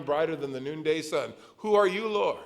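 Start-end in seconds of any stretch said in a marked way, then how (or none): 1.6-2.4 startled cry. Retold or imitated. Paul's question to Jesus.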